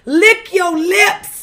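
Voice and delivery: drawn-out command. Acted. Telling a dry-lipped date what to do, slow drawn-out command. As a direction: stretch every word out. Exaggerated advice to bad kissers.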